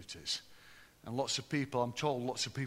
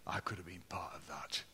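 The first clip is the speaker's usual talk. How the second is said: awed whisper; 7 semitones lower, 6 dB quieter than usual. Retold or imitated. Imagined ex-residents whispering in awe.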